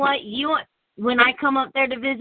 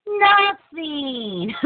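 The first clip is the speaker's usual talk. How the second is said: higher, coy mock denial; falsely innocent child; 4 semitones higher than usual. Child denying wanting anything, falsely innocent. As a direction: play innocent to a parent. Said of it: "I can hear it in that voice".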